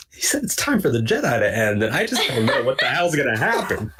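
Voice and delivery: hammed-up tearful wail, swooping high; comically weepy retelling; mock misty-eyed. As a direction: imitate being overcome, near tears, with a laugh under it. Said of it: "like, misty-eyed".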